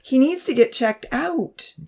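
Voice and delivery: high, drawn-out insistence; shaky. A narrator's reading. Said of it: "she said shakily".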